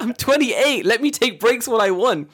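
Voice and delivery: loud, high-pitched comic outrage; hammed-up exasperated yell; indignant outburst. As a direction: protest in loud, high disbelief. Acted outrage at being coached.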